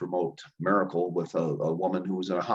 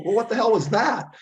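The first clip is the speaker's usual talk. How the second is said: much higher and louder, thrown by what he just saw; stunned disbelief; 13 semitones higher, 6 dB louder than usual. Retold or imitated. Startled student asking the old healer what he just did, in stunned disbelief. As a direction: blurt it out, completely baffled. Shocked by the remote healing.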